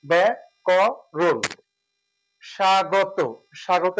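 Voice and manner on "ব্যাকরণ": ব্যাকরণ said very slowly as ব্যাকোরণ; drawn out ব্যাকরণ with o after r; slow model pronunciation. Shown as a demonstration. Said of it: "মাঝেরটা রয়ের সাথে অটা ও হয়ে যাবে". (ধীরে উচ্চারণ)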